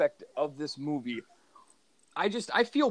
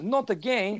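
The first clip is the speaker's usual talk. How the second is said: put-on failed French accent, sounds Italian; 6 semitones higher than usual. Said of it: "I can't even do it".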